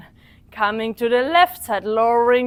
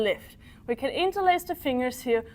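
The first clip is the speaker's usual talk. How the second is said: ordinary talk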